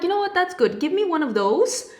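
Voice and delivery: high, upbeat retold reply; playfully over-keen customer; mock eager acceptance. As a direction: pitch it up as a chirpy yes to the offer. Retelling her eager acceptance at bank.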